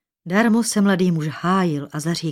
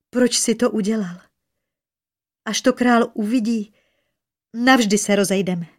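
higher, anxious reproach; frightened; 4 semitones higher, 3 dB louder than usual. A narrator's reading. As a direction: plead in mild alarm. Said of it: "strachy bez sebe". (ustrašená výčitka)

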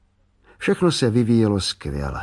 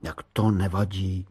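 ordinary delivery

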